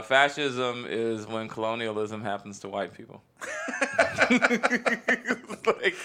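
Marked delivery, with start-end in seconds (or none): 3.4-5.6 high-pitched burst of laughter. Real, not acted. Reacting to the fascism punchline, laughing.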